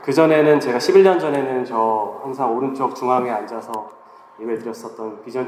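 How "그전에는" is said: ordinary talk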